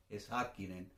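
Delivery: Häkkinen softly stressed on HÄK; soft, low Häkkinen, weight on the first syllable. Shown as a demonstration. Teacher corrects to the Finnish way, Häkkinen stressed on the first syllable. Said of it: "siempre cae en la primera sílaba".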